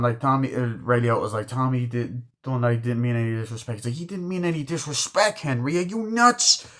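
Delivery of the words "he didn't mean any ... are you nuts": retold indignant outburst. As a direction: throw it back in disbelief, voice shooting up. Acted indignant reply to excuse.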